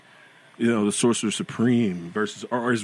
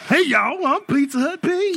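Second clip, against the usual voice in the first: very high squeaky mascot greeting; bright squeaky sing-song welcome. Acted. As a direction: chirp it like a cheesy mascot. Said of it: "That sounds like the worst mascot ever".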